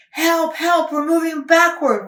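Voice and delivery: high, loud frightened wail; an engine pushed backward, wailing high and loud. Acted. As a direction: wail for help in a panic. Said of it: "wailed Henry".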